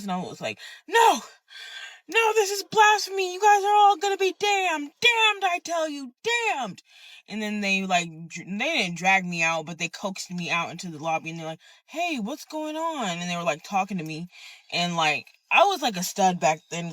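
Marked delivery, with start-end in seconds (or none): 0.9-6.8 high, loud fire-and-brimstone outburst; condemning shout. Retold or imitated. Refusing to leave and damning the congregation, high re-enacted shout. 11.9-13.1 high, slow, soothing voice; gentle concern. Retold or imitated. Church people checking on her in the lobby, soft high concern.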